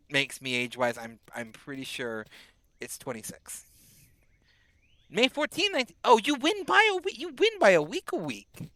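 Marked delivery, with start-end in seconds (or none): 5.1-8.7 high, bright burst of delight; thrilled at the close birthday; excited squeal of discovery. Real, not acted.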